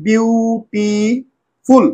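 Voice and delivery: "Beautiful" stretched into three slow beats; split into syllables. Shown as a demonstration. Teacher reading what she wrote, "beautiful" slowly in three parts. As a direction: pause between the three drawn-out parts of "beautiful". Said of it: "আমি তিনবার ভাঙবো".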